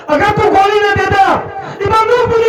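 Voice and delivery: high-pitched, insistent outburst; emphatic exclamation. Acted. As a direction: pitch it way up and declare it. Protests about gun and bullets.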